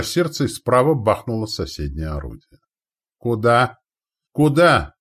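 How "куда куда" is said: loud, high call, rising then more insistent; shouted question. A narrator's reading. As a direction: demand to know where it hit. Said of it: "крикнул Ярилович".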